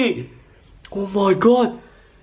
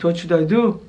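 ordinary talk, nothing marked

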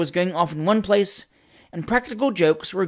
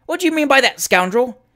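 high, loud challenge; indignant outburst; 8 semitones higher, 4 dB louder than usual. A narrator's reading. Samuel Wilkins challenges the rival in a high, loud outburst. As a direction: demand it, spoiling for a fight. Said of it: "exclaimed Mister Samuel Wilkins".

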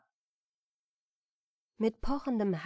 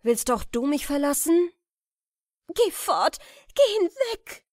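high, loud, pleading cry; desperate outcry; 8 semitones higher, 8 dB louder than usual. Acted. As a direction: cry out to drive him away, high and loud. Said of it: "rief Jokunde". (verzweifelt, hoch und laut rufend)

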